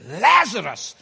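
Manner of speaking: calling the name out loud into the tomb. Retold or imitated. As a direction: ring the name out. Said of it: "Jesus stands there and he says".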